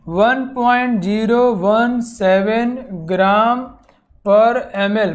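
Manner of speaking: slow, word-by-word dictation; model reading. Shown as a demonstration. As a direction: read one point zero one seven out slowly, digit by digit. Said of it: "जैसे पढ़ूँ वैसे तुम्हें पढ़ना है". (धीरे-धीरे, एक-एक अंक पढ़कर दिखाते हुए)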